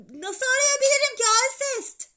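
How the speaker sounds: high, loud protest; boy loudly protesting down the line; shouting back. A narrator's reading. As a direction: yell it into the phone.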